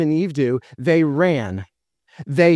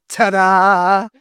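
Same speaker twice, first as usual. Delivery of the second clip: mock ta-da; 4 dB louder than usual. Mock triumphant reveal.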